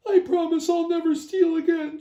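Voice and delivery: high, pleading little chipmunk. A narrator's reading. Chipmunk vowing to Gila Monster, high pleading. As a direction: beg in a squeaky critter voice. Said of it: "Chipmunk vowed".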